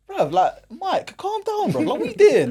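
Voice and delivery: high, disbelieving calm down; incredulous. Retold or imitated. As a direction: talk down an overreacting caretaker. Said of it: "everyone's looking at him like".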